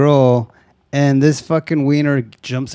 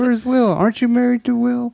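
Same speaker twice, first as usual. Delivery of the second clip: very high-pitched old lady impression; confused grandma; 11 semitones higher than usual. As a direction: put on a muddled grandma, pitched way up.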